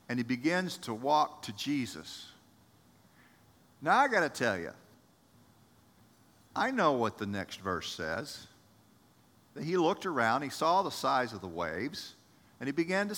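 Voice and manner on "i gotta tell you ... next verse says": usual speaking voice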